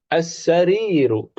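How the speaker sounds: as-sariru said slowly; model word. Shown as a demonstration. Teacher gives the last word to repeat, slowly. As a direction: draw out as-sariru for the class. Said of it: "ikuti setelah saya".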